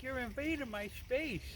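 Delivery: high, quiet squeaky squirrel grumble. Retold or imitated. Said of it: "He's like".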